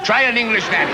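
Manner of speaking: lightly imitated big voice from the diaphragm. Comedian imitating the singer who suggested a nanny, hint of a diaphragm voice. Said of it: "Tom talks like that, you know, from his diagram".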